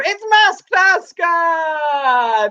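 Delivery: slow, high, stretched title call; big drawn-out game show reveal; game show announcer. Acted. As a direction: sell the segment name like a TV host. Announcing the show's game segment.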